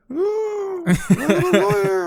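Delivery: very high, slow put-on snob voice; mocking snooty lawyer. Mocking the people who brag about being lawyers, very high and drawn out. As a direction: sneer it out like a self-important lawyer. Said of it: "they were just like".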